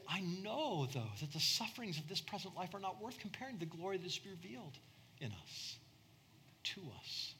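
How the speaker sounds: hushed scripture quote. Retold or imitated. Paul consoling with scripture, soft and fading. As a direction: drop to a near-hush for the promise. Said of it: "Paul's gonna say".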